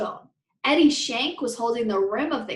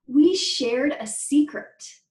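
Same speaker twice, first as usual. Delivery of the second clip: ordinary delivery, nothing marked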